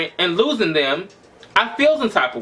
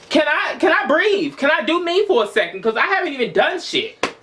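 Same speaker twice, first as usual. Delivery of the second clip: higher, pleading exasperation; exasperated; 7 semitones higher than usual. Retold or imitated. To his demanding dad, fed up and pleading. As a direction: beg for room to breathe.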